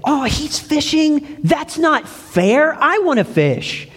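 put-on high, slow whiny kid pleading to fish; little boy voice. Pastor imitating a boy who wants to fish, high and whiny. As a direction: pitch it up like a small boy begging his mom. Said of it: "I heard him say".

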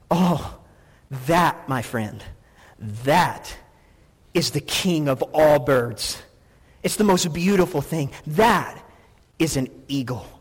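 lightly voiced storybook elder, low and measured; grave, unhurried wonder; awed chicken. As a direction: speak of the eagle with slow reverence, pausing after each phrase. Chicken reverently introduces the eagle.

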